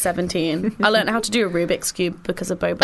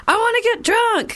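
mock party voice; 14 semitones higher than usual. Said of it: "I'm just like".

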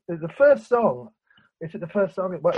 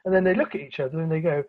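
ordinary delivery

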